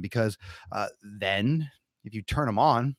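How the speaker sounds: normal talking voice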